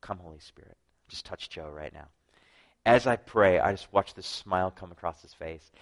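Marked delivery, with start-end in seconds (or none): 0.0-2.1 hushed, secret prayer in class; discreet prayer. Retold or imitated. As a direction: pray low so the teacher won't hear. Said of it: "and I discreetly go".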